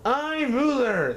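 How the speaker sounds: high, slow royal boast; boastful king. Acted. Yertle the turtle king boasting over his pond, high and slow. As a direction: proclaim it like a puffed-up turtle king. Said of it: "said Yertle".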